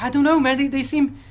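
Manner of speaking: lightly acted nervous pleading; nervous doubt. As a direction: hesitantly push back against the panic. Doubtful survivor answers the panicked one.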